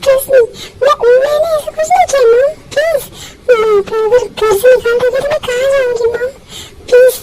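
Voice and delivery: sobbing, pleading with mom not to throw her out; crying plea. Acted. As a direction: beg through tears, voice cracking. (रोते हुए गिड़गिड़ाना)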